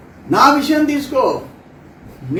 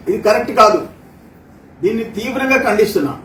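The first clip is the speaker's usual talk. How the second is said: ordinary talk, nothing marked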